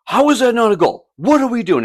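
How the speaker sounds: high, loud mock outraged coach; angry protest. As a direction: bark it back at the ref. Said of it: "He's like".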